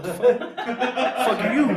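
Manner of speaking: high-pitched retold disbelief, laughing at the end; baffled indignation. His startled reaction to Nancy's entrance.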